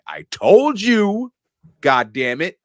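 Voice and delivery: high, slow, strained exasperated outburst; angry I-told-you. Retold or imitated. Carl snaps at Hans, angry and high. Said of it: "looks at Hans like".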